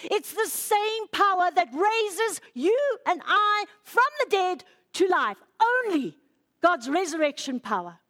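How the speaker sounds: impassioned proclamation; very emotional. Real, not acted. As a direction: preach it carried away by emotion. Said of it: "I'm getting very emotional".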